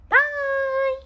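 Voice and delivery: high, bright farewell call; cheerful goodbye. Acted. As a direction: wave them off happily. Waving the birds off.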